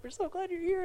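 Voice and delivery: high-pitched imitation of fawning girls; imitated gushing girls. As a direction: gush a welcome in a high put-on voice. Voicing welcoming youth group girls.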